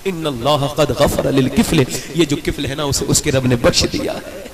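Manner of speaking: solemn loud declaration; solemn slow proclamation. Real, not acted. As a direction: proclaim it like a divine decree, slowly. Solemn announcement recited.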